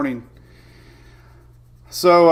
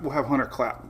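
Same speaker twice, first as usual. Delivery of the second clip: ordinary talk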